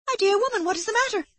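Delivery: startled neighbour seeing her friend collapse; alarmed. A narrator's reading. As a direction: cry out in sudden concern. Aurora alarmed at Amanda.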